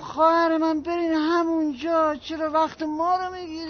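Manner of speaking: high, exasperated brush-off. Acted. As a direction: pitch up, losing patience with her. Agent loses patience with tenant. (با کلافگی و صدای بالا)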